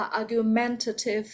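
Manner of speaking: "argumentative", slow, with stress on MEN; third-syllable stress. Shown as a demonstration. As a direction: slowly say "argumentative" as argu-MEN-tative. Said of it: "nó nhấn ở âm ba em ạ".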